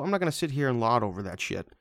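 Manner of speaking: normal talking voice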